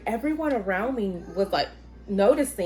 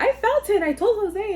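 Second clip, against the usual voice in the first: put-on very high, insistent kid voice; squeaky mock protest; imitated son; 13 semitones higher, 3 dB louder than usual. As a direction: pipe up like an eager son. Young son's insistent reply acted out.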